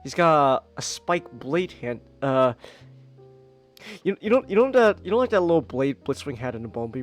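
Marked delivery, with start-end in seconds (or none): none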